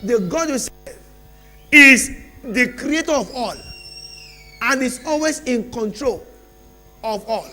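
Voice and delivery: loud, rising sermon bursts with long pauses; preaching at full force. Acted. Preacher promising the congregation change, loud and rising. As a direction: drive the promise home to the church.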